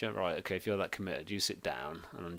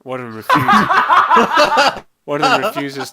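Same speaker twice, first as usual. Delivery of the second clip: squeaking with laughter; cracking up mid-sentence; laughing; 17 dB louder, slower than usual. Real, not acted. Laughing through the line.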